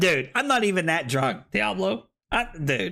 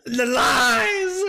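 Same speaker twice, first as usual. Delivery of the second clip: mock outrage; 7 semitones higher, 3 dB louder, much slower than usual. Mock betrayal over spiked drink.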